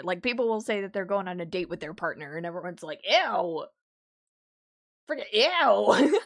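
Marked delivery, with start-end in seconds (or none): 3.1-6.2 loud, drawn-out disgusted ew; hammed-up grossed out; mock disgust.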